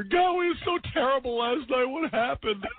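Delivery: little sister voiced high and distraught, a mock whine; upset little sister. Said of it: "my crying little sister".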